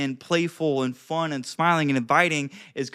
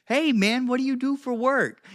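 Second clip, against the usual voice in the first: higher, bright friendly ask; upbeat question; 7 semitones higher than usual. Shown as a demonstration. Coach showing a friendly opener, upbeat. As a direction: ask it like you're glad to meet them. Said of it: "if you ask somebody a question".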